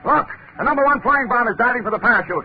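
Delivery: high loud alarm; alarmed shout. Acted. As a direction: shout the warning as the bomb dives. Bomb diving, alarmed shout.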